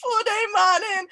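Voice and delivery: a laughing, hammed-up Guyanese accent, pitched high; deliberately bad Guyanese accent through a laugh; mock Guyanese accent said while cracking up. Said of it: "Said in a very bad Guyan-, Guyanese accent".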